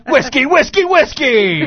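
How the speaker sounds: high, loud echo chant; echoing announcer voice. Acted. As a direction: boom it out like an arena echo. Echo in monster truck ad.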